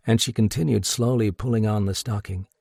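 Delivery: usual speaking voice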